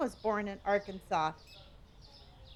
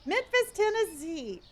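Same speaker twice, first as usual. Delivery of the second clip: Memphis, Tennessee in a high, oddly bouncing voice; odd high reading; 8 semitones higher than usual. Real, not acted. Streamer reads a viewer's city, oddly high and sing-song. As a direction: blurt the place name up high, oddly sung. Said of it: "why did I say it like that?".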